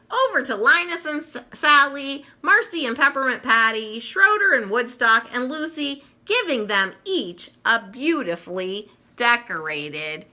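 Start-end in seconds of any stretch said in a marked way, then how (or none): none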